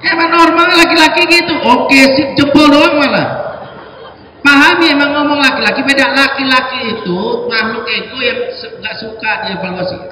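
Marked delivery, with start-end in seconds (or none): 0.0-6.1 louder, higher emphatic point. Real, not acted. Preacher loudly telling wives men answer with a thumb. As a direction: drive the point home loudly.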